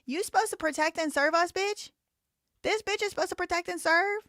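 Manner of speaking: high, loud incredulous challenge; indignant outrage. Real, not acted. Livestreamer scolds the woman meant to protect and serve, indignant. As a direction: raise pitch and volume, demanding disbelief.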